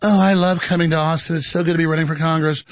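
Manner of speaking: lightly voiced prim politician; a hint of a prim, proper speaker; mock prim voice. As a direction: parrot her polished voice.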